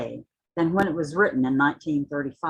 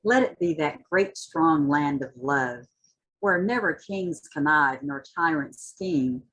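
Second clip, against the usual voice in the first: rising, impassioned spoken-word reading; dramatic poetry reading with swelling intensity; poem recitation; 4 semitones higher than usual. Acted. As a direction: recite it like a rallying cry, building toward the end. Recites Hughes poem after introduction.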